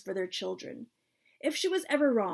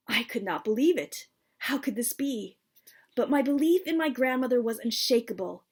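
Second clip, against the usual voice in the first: plain reading voice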